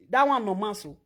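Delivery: ordinary talk, nothing marked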